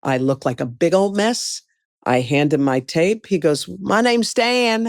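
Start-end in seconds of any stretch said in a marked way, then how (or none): 3.8-4.9 drawn-out, higher-pitched folksy introduction; Stan Sandroni. Retold or imitated. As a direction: imitate a slow-talking local news director. Voices Stan, the Greenville director.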